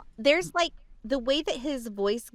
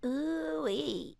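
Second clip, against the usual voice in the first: soft, squeezed ooh-wee; squeezy voice; 3 semitones higher, 10 dB quieter than usual. Shown as a demonstration. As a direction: squeeze it out quietly. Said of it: "And it's squeezy".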